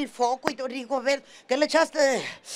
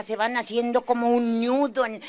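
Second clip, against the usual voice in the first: usual speaking voice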